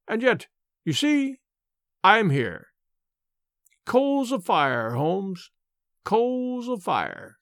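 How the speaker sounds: high-pitched villain, drawing words out slowly; Mister Smith, gloating. Acted. Visitor gloating over sick Holmes, mocking. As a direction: relish each word as a triumphant visitor.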